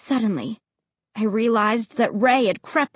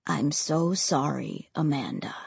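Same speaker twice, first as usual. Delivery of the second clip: a soft apology from beyond; sad ghost boy; 9 semitones lower, 8 dB quieter than usual. A narrator's reading. Ray's voice in the story.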